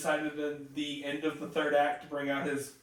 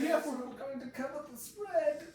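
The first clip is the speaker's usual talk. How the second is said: mock interviewee; 11 semitones higher, 7 dB quieter than usual. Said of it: "He's like".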